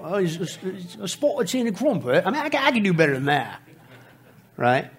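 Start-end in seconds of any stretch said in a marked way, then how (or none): 0.1-2.2 hammed-up fake British accent; mock a phoney British accent, badly faked. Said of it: "really bad British accent".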